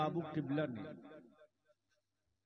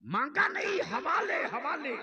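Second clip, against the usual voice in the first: raised preacher's cry; high-pitched declamation; 9 semitones higher, 5 dB louder than usual. Real, not acted. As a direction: lift the voice high to drive it home. Preacher driving the point home.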